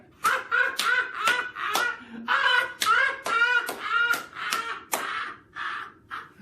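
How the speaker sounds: high shrieking cackle; horrible witchy cackle. Acted. Wife cackling at her husband, shrieking. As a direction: cackle like a wicked old witch. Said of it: "rocking with horrible laughter".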